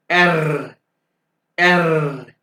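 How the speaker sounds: एर with a long trilled r, loudly; vibrated German R. Shown as a demonstration. Teacher models R for students, loud rolled एर. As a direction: roll the tongue hard on each एर. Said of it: "यहाँ पे जुबान को vibrate करें".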